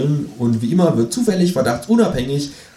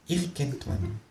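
much softer, near-whispered; murmured; 4 semitones higher, 14 dB quieter, slower than usual. A narrator's reading. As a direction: let it fade into a mutter. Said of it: "murmelt das Känguru".

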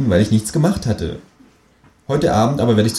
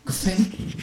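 high, softer gefällt mir with a rise; kangaroo voice, softer; 12 semitones higher, 5 dB quieter than usual. A narrator's reading. Känguru repeats its approval, softer and high. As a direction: repeat it quietly to yourself.

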